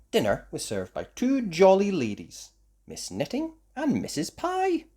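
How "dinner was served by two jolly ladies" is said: plain reading voice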